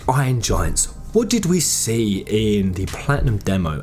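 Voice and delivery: ordinary delivery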